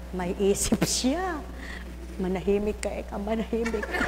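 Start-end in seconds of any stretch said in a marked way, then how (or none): 2.2-4.0 very soft, low-key order; hushed telling-off. Retold or imitated. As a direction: say it under your breath, well below normal volume. Telling someone to keep quiet.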